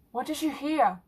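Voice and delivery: talking through chips; eager question round a mouthful; mouth full. Acted. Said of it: "said with a few chips in her mouth".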